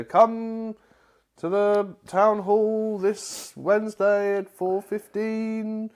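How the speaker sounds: slow, drawn-out public announcement; town crier. Acted. Acting out old town announcements.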